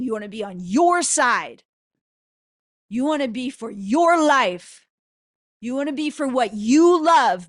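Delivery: loud, driving sermon cadence; preaching at full force. Acted. Preacher urging listeners to choose their own side, loud and driving. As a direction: hammer each line home like a preacher.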